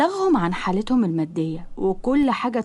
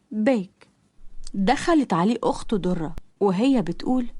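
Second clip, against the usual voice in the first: plain reading voice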